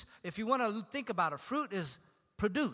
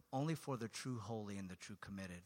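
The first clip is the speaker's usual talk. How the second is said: quiet inner voice; 10 semitones lower, 8 dB quieter than usual. Retold or imitated. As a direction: lower and softer, a doubting inner voice. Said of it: "inner something ... that says".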